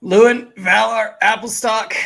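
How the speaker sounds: loud, high roll call to the crew; commanding captain's call; calling out names. Acted. Captain summons crew for a fight.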